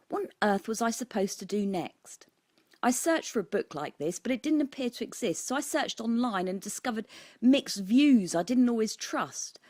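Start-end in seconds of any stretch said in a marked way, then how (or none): none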